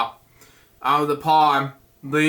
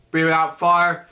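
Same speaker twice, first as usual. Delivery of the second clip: usual speaking voice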